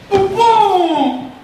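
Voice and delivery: loud cry with a laugh; laughing shout. Acted. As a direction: call out loudly, laughing.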